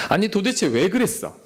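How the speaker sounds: mock rebuke pitched high; exasperated scolding. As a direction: scold in disbelief. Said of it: "화를 돋구는 말들이죠". (어이없다는 듯 높게 나무라기)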